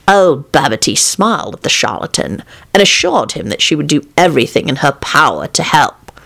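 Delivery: ordinary delivery, nothing marked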